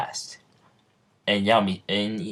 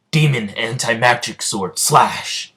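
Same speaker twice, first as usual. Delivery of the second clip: loud, higher attack call; battle cry; 3 semitones higher, 5 dB louder than usual. Acted. Asta runs at Noel swinging his sword, battle cry. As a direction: call out the special move, punching the last word.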